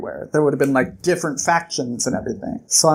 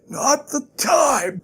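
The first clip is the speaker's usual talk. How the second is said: slow, high, strained words; acted hurt groan; pained rebuke; 10 semitones higher, much slower than usual. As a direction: force it out through mock pain. Hurt Kvothe cuts Bast off.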